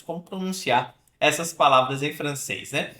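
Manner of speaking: normal talking voice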